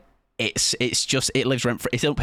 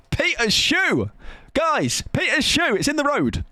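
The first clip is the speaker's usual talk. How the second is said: mock shout; slower than usual. Streamer imitates the clip, yelling for his mate Peter. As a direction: call it out like a mate yelling down the road.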